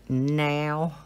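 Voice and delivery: "now" drawn out with a southern twang. Shown as a demonstration. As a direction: stretch "now" into a southern "nee-ow". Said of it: "it's got a southern twang to it".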